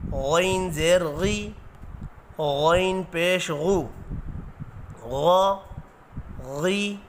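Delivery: slow, spelled-out Qaida drill; syllable drill. Shown as a demonstration. Teacher drilling the class through Noorani Qaida syllables. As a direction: spell each letter with its harakat slowly.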